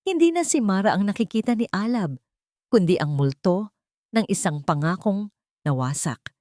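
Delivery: usual speaking voice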